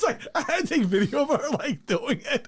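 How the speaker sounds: ordinary talk, nothing marked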